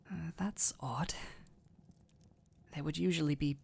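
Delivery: hushed words to himself, unvoiced; whispered aside. Caretaker puzzling to himself about the silent thief, whispered. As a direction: whisper it under your breath.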